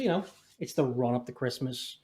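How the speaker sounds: ordinary delivery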